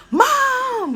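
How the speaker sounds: very high, loud yell; yelling for mom. Retold or imitated. Cousin yelling to her mom driving past, loud and high. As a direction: shout across the street for her mom. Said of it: "My cousin goes".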